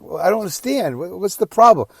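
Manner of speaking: high-pitched, perplexed questioning; baffled disbelief. Retold or imitated. Son who can't believe what he hears from dad, baffled. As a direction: plead for an explanation, baffled. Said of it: "I couldn't believe what I was hearing".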